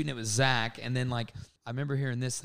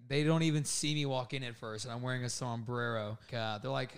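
ordinary talk, nothing marked

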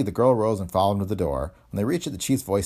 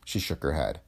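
ordinary delivery

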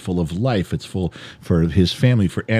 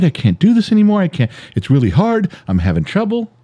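mock breakdown; 10 semitones higher than usual. Husband breaking down before his wife, mock-tearful. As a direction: act out cracking under strain, without real tears. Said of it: "you broke down and cried".

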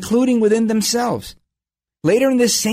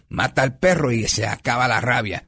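quoting dad's refrain; 6 semitones lower than usual. Retold or imitated. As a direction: murmur the old saying as dad would, lower. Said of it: "his popular refrains".

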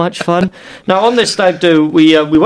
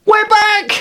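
excited shout. Acted. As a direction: burst back on air. Radio show return, hyping.

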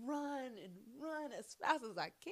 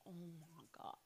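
hushed oh my god; 4 semitones lower, 20 dB quieter than usual. Retold or imitated. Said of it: "And I'd be like".

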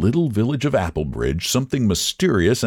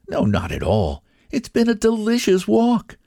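much higher woman's voice, lightly played; contented woman. A narrator's reading. Wife answering her husband in the meadow, content. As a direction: answer cheerfully after a long walk. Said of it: "said the woman".

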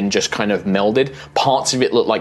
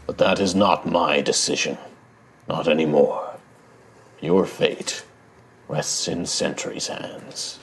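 deep rumbling voice; 3 semitones lower than usual. Acted. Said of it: "this deep rumbling voice".

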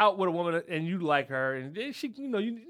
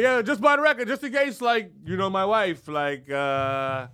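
higher, louder put-on voice for the old man; old man insisting; 3 semitones higher, 6 dB louder than usual. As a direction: play the fussy old guy covering himself. Old guy insisting he's faithful.

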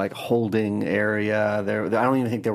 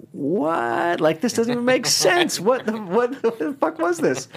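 much higher, drawn-out mock disbelief; retold bafflement; 13 semitones higher, slower than usual. Reading stoned notes the next morning, acting baffled. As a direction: act out being utterly baffled, pitched way up.

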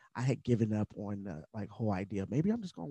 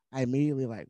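ordinary talk

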